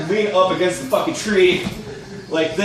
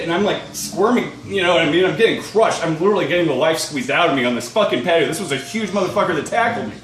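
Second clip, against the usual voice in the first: ordinary delivery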